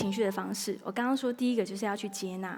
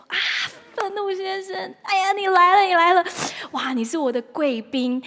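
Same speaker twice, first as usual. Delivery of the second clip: much higher and louder, gushing hello; delighted welcome; 9 semitones higher, 8 dB louder than usual. Acted. Preacher greeting Mr Anger as an honored guest, gushing. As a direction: greet him like a long-awaited friend. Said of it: "而是说".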